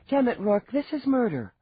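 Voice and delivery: annoyed outburst at a husband; exasperated protest. A narrator's reading. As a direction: protest in exasperation, high and forceful.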